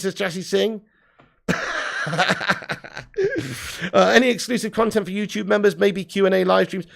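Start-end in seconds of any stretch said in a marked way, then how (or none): 1.5-3.9 long burst of chuckles; laugh. Real, not acted. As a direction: crack up at a comment. Laughs at a comment.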